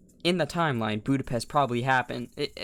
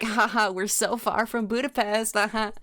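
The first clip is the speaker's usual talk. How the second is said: mock flippant joke; 6 semitones higher than usual.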